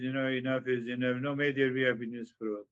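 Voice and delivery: the mind brushing it off quickly; mind's casual voice. Retold or imitated. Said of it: "and now your mind says".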